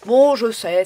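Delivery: normal talking voice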